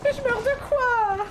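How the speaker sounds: high loud yelp; startled shriek; shocked scream. Real, not acted. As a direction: scream at the sudden hit. Dying in game.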